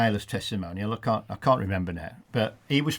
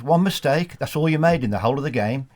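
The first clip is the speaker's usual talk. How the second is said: mock gloat; 5 semitones higher, 7 dB louder than usual. Manager teasing ref after match.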